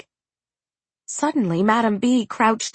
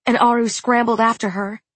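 usual speaking voice

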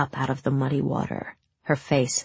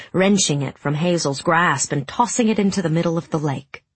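ordinary delivery, nothing marked

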